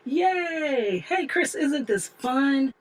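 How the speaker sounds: high, drawn-out playful cheer; gleeful child. Retold or imitated. Child to sister riding the homemade skateboard, gleeful cheer. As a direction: cheer like a kid mid-ride.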